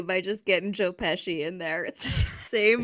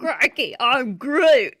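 loud, laughing Aussie Groot; mock Australian; 2 semitones higher, 7 dB louder, slower than usual. Co-host joking about the Australian dub, loud and laughing. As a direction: shout it like a cartoon Aussie. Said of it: "He also says "crikey".

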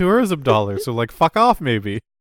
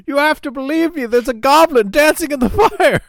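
high, loud, frantic Shatner plea; William Shatner impression; 11 semitones higher, 6 dB louder than usual. Friends riffing on a TV episode, hammy panicked Shatner. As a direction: ham up a panicked Twilight Zone Shatner. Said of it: "that episode of Twilight Zone with William Shatner".

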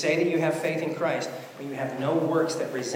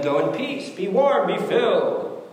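mock blessing; 6 semitones higher, 6 dB louder, slower than usual. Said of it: "one of you says to them".

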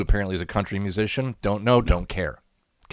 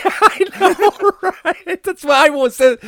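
talking through high laughter; laughing; 5 dB louder, slower than usual. Real, not acted. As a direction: giggle the words out. Laughing at the R-Truth story.

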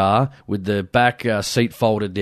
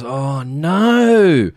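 higher, louder mock groan of dismay; 5 semitones higher, 3 dB louder than usual. Mate voiced finding he forgot binoculars, hammed-up dismay. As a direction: play the friend's hammed-up dismay.

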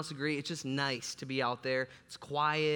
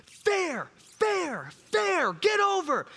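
much higher, loud shouted plea to the ball; Carlton Fisk plea; 7 dB louder, much slower than usual. Retold or imitated. Boy willing his golf ball away from the driveway, high pleading shout. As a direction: yell at the ball to stay fair. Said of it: "I'm doing the Carlton Fisk".

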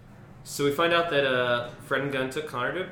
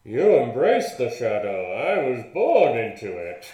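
loud, menacing masked villain voice; Bane impression; 9 dB louder than usual. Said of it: "Bane in The Dark Knight Rises".

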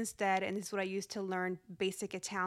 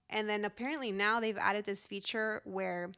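ordinary talk, nothing marked

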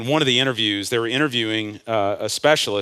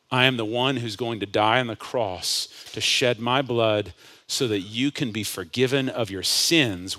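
ordinary talk

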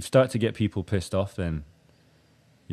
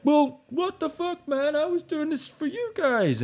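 much higher, slow, stretched-out whine; hammed-up hurt complaint to an empty room; whiny offended musician; slower than usual. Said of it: "then you're like".

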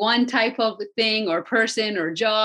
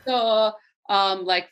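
normal talking voice

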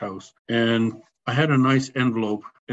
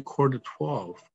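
normal talking voice

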